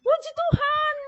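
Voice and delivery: high-pitched exclamation of praise; nurse's shout. Retold or imitated. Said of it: "Dia teriak".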